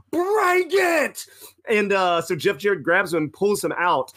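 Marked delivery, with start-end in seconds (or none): 0.1-1.2 high, bowed-up challenge; mock defiant yell. Fan retelling yelling back at the wrestler, mock yell. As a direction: shout it like picking a fight. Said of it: "bowed up and went".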